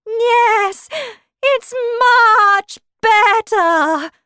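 high, loud, slow, starting to bleat; strange changing voice. Acted. Queen's voice turns strange as she answers, slowly bleating. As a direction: stretch the words as if turning into a sheep. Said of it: "her voice sounded strange".

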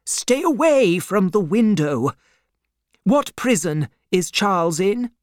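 high, pressing command and question; urgent alarm. A narrator's reading. Banker keeps doctor from window, urgently questioning.

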